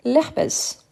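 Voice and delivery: l'herpès run together as one word, h unsounded; slow teacher demo of l'herpès with no h; silent h demo. Shown as a demonstration. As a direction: glide straight from l' into l'herpès, slow and clear. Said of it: "c'est un H muet, donc je dis".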